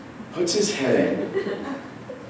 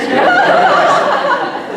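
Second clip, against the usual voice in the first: a high, loud burst of laughter; high squealing laugh; 7 dB louder than usual. Real, not acted. Comic cracking up at the bitchy kid, high squeal of laughter. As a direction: cracks up at his own line. Teller cracks up mid-story.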